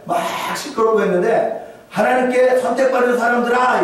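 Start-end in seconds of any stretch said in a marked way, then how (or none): 1.9-3.8 high, loud call over a crowd; rousing call. Acted. Gamaliel calling over the shouting council, high and loud. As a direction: lift it up and out over the din.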